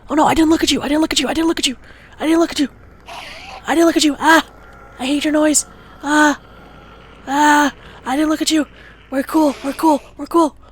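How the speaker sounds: high, loud frantic cries; panicked yelling. Real, not acted. Player panicking as the Enderman attacks, screaming.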